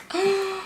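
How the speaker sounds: quick high intake of breath; sharp gasp. Real, not acted. Reviewer struck mid-thought about her palette, sharp gasp. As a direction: catch your breath in a sudden gasp.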